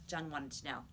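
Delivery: ordinary delivery, nothing marked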